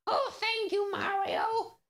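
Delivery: squeaky high, soft mushroom voice; timid little Toad asking. Pretend. Toad asks Mario shyly in a squeaky voice. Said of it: "Can you do Toad's voice?".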